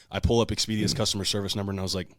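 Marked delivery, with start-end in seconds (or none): none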